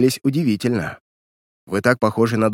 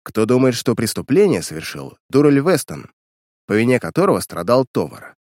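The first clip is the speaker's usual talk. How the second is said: plain reading voice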